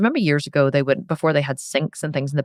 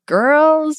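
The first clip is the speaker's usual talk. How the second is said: put-on much higher, louder call of girls; shouting; 11 semitones higher, 5 dB louder than usual. Dad shouting for his daughters, loud and high. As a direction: call the girls from across the house. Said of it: "and he was shouting".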